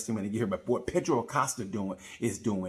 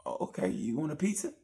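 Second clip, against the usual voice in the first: hesitant coaxing; 5 semitones higher than usual. Retold or imitated. Coaxing a sulking friend.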